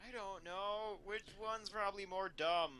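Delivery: ordinary delivery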